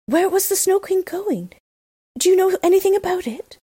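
put-on high, urgent little girl voice; eager Gerda. A narrator's reading. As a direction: ask quickly, anxious to know. Gerda voiced asking pigeons.

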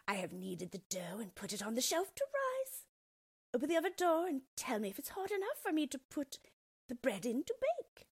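high, coaxing witch voice; sly witch. Acted. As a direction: lure her toward the oven. Witch tricks Gretel.

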